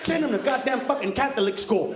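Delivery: ordinary talk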